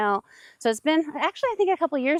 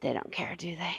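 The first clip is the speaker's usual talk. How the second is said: quiet aside; 5 semitones lower, 11 dB quieter than usual. Real, not acted. Quiet aside about the animals.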